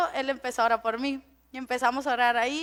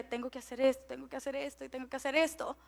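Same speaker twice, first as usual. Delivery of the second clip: 1 racing thoughts; 2 semitones lower, 13 dB quieter, faster than usual. Retold or imitated. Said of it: "cosas que me traen de".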